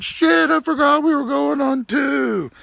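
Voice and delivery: high, slow, dawning dismay; the sinking moment you realize your mistake; dismayed realization. Retold or imitated. Player realizing he forgot the snap count, dismayed. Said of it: "throws his hands to his head and is like".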